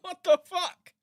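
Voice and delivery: high laughing disbelief; cracking up in disbelief; exasperated laughter. Real, not acted. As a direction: laugh helplessly at how absurd it is. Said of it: "I'm fucking losing my mind".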